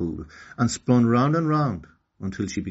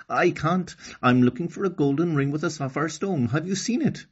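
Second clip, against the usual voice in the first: voicing Okani; 8 semitones higher than usual. Okani questions the fish.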